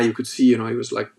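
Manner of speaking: usual speaking voice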